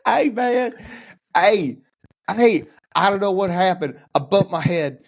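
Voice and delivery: high-pitched mock Southern head-injury patient; over-acted Southern accent, high and dazed. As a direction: ham up a confused Southerner, voice way up. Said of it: "always have a Southern accent".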